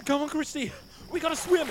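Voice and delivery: high urgent plea; desperate and rushed. Acted. Urging her to swim.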